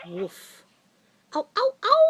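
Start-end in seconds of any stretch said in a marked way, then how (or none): 1.3-2.0 yelp. Shown as a demonstration. Animal sound game.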